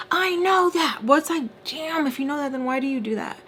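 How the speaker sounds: high-pitched heated outburst; exasperated. Retold or imitated. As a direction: argue back, fed up. Heated back-and-forth over the phone.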